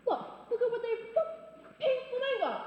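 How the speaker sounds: high, appalled cry; outraged exclamation. Acted. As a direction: point and cry out, scandalised. Shows the offending lawn birds.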